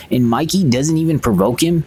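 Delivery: ordinary talk, nothing marked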